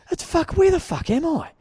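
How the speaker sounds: high-pitched exasperated wail, acted out; retold exasperation. Retelling being lost, tortured by it.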